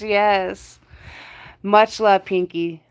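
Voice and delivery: ordinary talk, nothing marked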